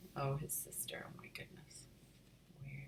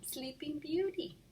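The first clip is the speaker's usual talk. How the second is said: teasing; 11 semitones higher, 3 dB quieter, slower than usual. Acted. Mom teasing.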